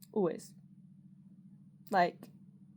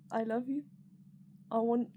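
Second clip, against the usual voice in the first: usual speaking voice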